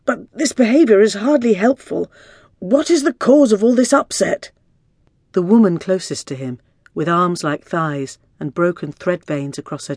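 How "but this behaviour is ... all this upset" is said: raised, higher and louder protest; exasperated remonstrance. A narrator's reading. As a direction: demand an explanation from an unruly crowd. Doctor remonstrates with angry crowd.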